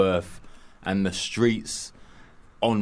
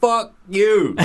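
mock defiant; 12 semitones higher, 6 dB louder than usual. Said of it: "he's like".

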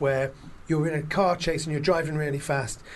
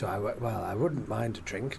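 low, quiet hesitant acceptance; imitated detective; 7 semitones lower, 7 dB quieter than usual. Detective accepting wife's drink offer, low imitated reserve. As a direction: lightly play a reserved man, low and soft. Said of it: "And he goes".

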